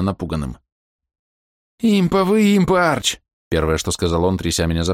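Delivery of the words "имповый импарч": panicked shout. Acted. Panicked companion Maus.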